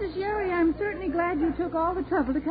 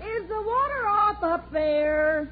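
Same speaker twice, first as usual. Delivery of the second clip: high, slow, drawn-out call; 6 semitones higher, slower than usual. Acted. Mother asking son upstairs, calling out. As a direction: stretch the question out so it carries.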